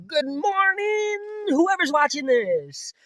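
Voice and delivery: high, slow, stretched announcer voice; drawn-out playful hello to viewers; mock announcer greeting.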